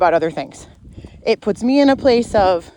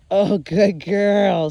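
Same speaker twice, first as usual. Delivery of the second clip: very slow, stretched praise; much slower than usual. Real, not acted. Trainer praising dog at her side, very slow stretched praise. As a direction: pour the praise out slowly. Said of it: "just a little dopamine".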